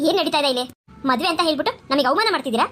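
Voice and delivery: an offended mother-in-law demanding answers; indignant groom's mother. Acted. Groom's mother feels insulted.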